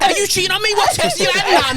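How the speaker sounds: put-on jealous girlfriend. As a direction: put on a suspicious girlfriend's whine. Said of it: "Your bear's like".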